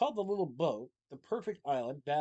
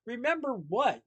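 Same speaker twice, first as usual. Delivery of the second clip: alarmed question; 8 semitones higher, 7 dB louder, slower than usual. A narrator's reading. Willie alarmed.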